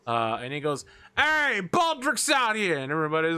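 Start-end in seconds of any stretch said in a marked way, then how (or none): 1.2-2.8 high, drawn-out rat shout; loud shouted announcement. Acted. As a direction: holler the news to the whole pack. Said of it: "and then shouts really loudly".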